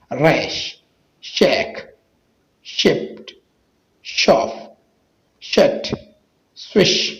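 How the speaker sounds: isolated words with a clear sh; SH as "sheen". Shown as a demonstration. Teacher drilling SH words for viewers, clear sh sound. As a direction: say each word separately, SH as in "शीन". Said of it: "एसएच शीन का आवाज़ देता है".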